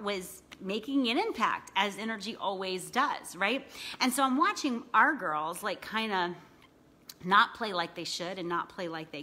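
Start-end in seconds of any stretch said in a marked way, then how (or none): none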